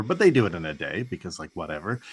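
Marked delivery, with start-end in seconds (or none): none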